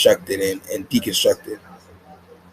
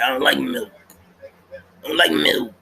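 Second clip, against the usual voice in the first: put-on higher, whining childish voice; whiny kid Ed; 4 semitones higher than usual. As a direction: pout it like a sulky kid. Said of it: "He's like".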